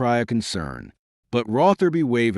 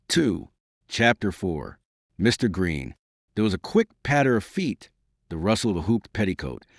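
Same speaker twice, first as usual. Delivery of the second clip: ordinary delivery, nothing marked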